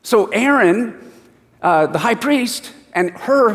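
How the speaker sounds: ordinary talk, nothing marked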